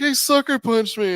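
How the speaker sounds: high, loud, drawn-out accusation; hammed-up indignant tattling; mock whiny complaint. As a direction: imitate an aggrieved kid protesting. Kid's indignant complaint, retold.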